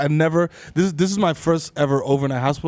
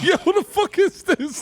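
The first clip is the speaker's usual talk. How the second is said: incredulous. Retold or imitated. Retold disbelief.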